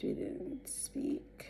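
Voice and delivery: slow hushed whisper. Real, not acted. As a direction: breathe it out slowly. Confiding aside.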